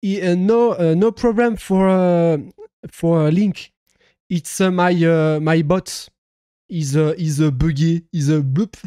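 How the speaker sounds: loud, faltering English in French accent. Real, not acted. Streamer explaining his bot to a foreign viewer, faltering French-accented English. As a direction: grope for English words, very French. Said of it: "de mon accent anglais".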